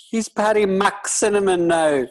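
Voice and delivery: high, loud, slow stage-Irish voice; bad Irish accent. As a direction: ham up a bad Irish brogue. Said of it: "terrible Irish accent".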